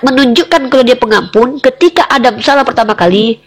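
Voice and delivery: ordinary talk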